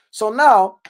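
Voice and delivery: usual speaking voice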